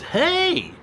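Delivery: high bright quoted hey. Retold or imitated. Reading a viewer's comment, bright high hey. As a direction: chirp the greeting. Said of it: "here's somebody that says".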